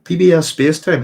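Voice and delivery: calls the name out clearly to the chat; loud. Real, not acted. Repeats the name to check it.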